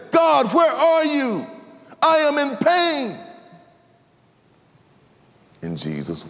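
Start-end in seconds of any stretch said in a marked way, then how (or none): 0.1-3.2 high, slow, pained plea; anguished cry to God. Acted. Believer on his knees to God, high anguished cry. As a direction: cry up to heaven in pain.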